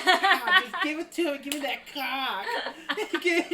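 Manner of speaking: loud, high East Coast caricature; mock East Coast accent. Host mimicking East Coast women in bed, mock accent. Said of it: "like, talking like that".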